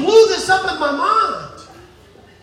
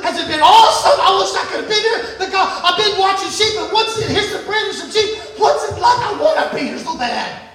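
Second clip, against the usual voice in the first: higher, gushing excitement; eager young David; 3 semitones higher, 4 dB louder, faster than usual. Acted. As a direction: pepper the brothers with eager questions.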